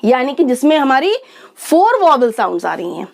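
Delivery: ordinary talk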